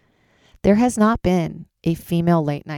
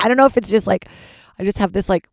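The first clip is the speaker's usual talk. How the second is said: ordinary delivery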